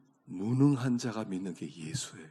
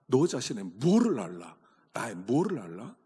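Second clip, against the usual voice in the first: normal talking voice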